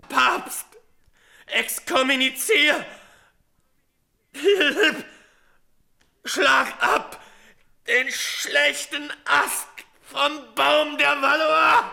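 rally them with his last breath; impassioned dying cries. Acted. Dying Guise calls for vengeance.

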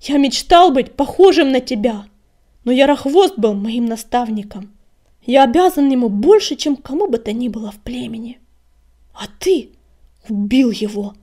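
emphatic, clearly worded reproach; loud and distinct. A narrator's reading. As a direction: declare it loudly, word by word. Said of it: "отчётливо выговаривая каждое слово".

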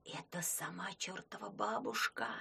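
soft, awed whisper. Acted. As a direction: whisper it behind her back. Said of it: "шепталась за её спиной нечисть".

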